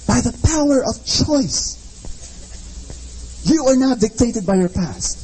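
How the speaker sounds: rising, rhythmic motivational preaching; fervent preacher building to a call. Acted. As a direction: drive each choice home to the congregation.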